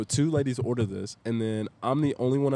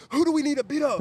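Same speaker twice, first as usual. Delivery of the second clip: high-pitched, imitated battle readiness; rally to a friend's defence, voice way up; fired-up backing; 4 dB louder, faster than usual. Loyal friends answer his text, imitated fired-up eagerness.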